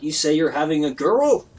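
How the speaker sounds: ordinary delivery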